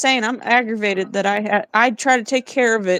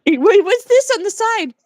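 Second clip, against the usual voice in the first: mock-startled, much higher pitch; distracted viewer; 11 semitones higher than usual. Mimicking easily distracted viewers to the co-host, mock-startled. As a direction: act suddenly sidetracked by something shiny.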